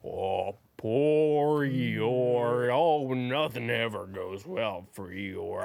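slow, low mopey donkey voice; Eeyore impression. Eeyore sulking to his friends, low and slow. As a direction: moan it like a sad donkey. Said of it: "he's just going around going".

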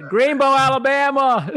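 put-on proud Southern boy announcing where he's from, with a laugh; Forrest Gump voice. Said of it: "Here comes my Forrest Gump".

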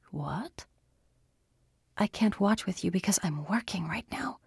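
urgent hushed whisper; whisper yelling. Acted. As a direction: whisper it sharply. Andy whispering to Chris.

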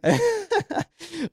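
short high amused laugh. Real, not acted. Host laughing at his own New York slip, high laugh.

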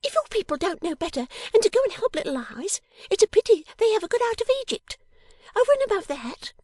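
very high, loud, indignant character voice; high-pitched girlish indignation; scolding little dressmaker. Acted. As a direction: rattle off the rebuke high and pert. Plays Miss Wren in story.

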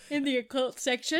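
talking through a yawn. Real, not acted. Co-host asking about the library's occult section, yawning. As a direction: let a yawn stretch the question. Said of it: "Sorry, I was yawning".

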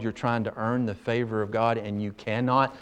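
normal talking voice

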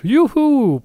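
high, loud whoop; mock cheer. Host cheering his own throat joke, mock whoop.

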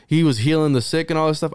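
normal talking voice

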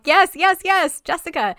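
high, loud, emphatic cheer; excited cheering. Retold or imitated. Fan cheering on an all powerful Jessica, excited.

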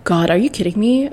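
ordinary talk, nothing marked

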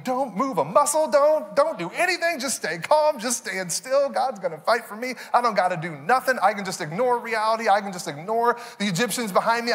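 a sing-song mock of lazy faith; mock naive believer. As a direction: parody someone who just sits and waits. Mocking a passive believer.

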